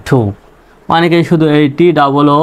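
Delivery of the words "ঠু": "ঠু" with breath released on the t; English aspirated T. Shown as a demonstration. Teacher says "like this", aspirated "ঠু". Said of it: "এরকম".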